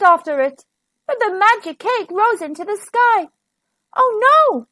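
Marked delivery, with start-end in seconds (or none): 3.9-4.7 high-pitched storybook dismay; worried, high exclamation; alarmed cry. A narrator's reading. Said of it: "cried Wilf".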